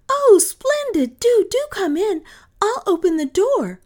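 put-on very high, loud, eager little girl; delighted child's cry. A narrator's reading. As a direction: burst out thrilled, inviting a guest in. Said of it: "now cried".

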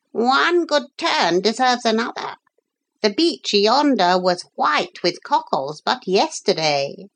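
high, formal parrot voice; grateful parrot. Acted. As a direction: return the favour as a courteous parrot. Grateful parrot tips off gulls.

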